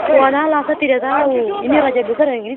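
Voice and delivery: ordinary delivery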